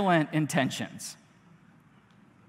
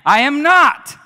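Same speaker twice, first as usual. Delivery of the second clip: loud denial; 7 semitones higher, 12 dB louder, slower than usual. A narrator's reading. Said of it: "But Peter said".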